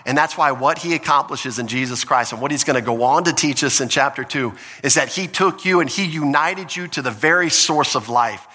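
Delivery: normal talking voice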